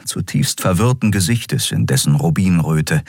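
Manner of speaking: ordinary delivery, nothing marked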